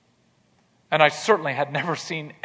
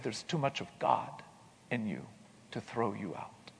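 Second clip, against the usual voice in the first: soft reassurance; 5 semitones lower, 12 dB quieter than usual. Retold or imitated. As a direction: let it fall quietly, full of grace. Professor softly refusing to expel him.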